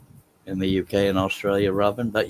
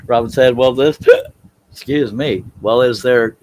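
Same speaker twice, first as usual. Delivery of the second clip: louder, higher, broken up by hiccups; real hiccups; 5 semitones higher, 8 dB louder than usual. Real, not acted. Streamer reading Robin's chat question aloud, interrupted by hiccups. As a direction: hiccup mid-sentence and push on. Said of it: "I don't know that she hiccuped".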